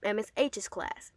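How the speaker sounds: ordinary talk, nothing marked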